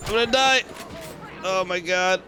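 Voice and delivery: rising panicked repeated cries; panicking gamer. Real, not acted. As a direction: spiral into panic, voice climbing. Low health panic.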